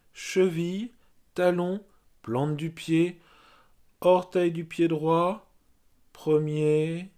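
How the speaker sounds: measured meditation voice, spaced by pauses; guided yoga nidra. Real, not acted. Yoga teacher naming body parts for resting listeners, guided meditation voice. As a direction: guide awareness through the body, one evenly placed phrase at a time. Leads body rotation of consciousness.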